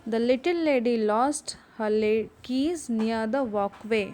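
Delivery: slow, careful reading for the class; deliberate teacher read-aloud. Shown as a demonstration. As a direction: read it slowly so they can follow. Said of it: "उसको ज़ोर से पढ़ेंगे".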